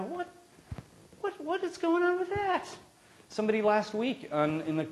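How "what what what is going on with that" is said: much higher, baffled outburst of disbelief; incredulous what. Acted. Preacher voicing the baffled listener, high incredulous what. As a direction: squeak it out like you can't believe it.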